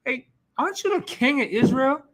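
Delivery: a hint of a stern wife shaking him up; Jezebel scolding. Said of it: "she said".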